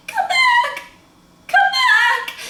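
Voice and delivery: high, loud dramatic calling out; Rose from Titanic. Retold or imitated. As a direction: cry out like Rose on the sinking ship. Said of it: "on Titanic when Rose is like".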